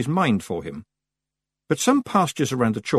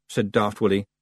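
plain reading voice

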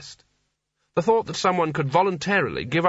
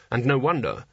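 ordinary delivery, nothing marked

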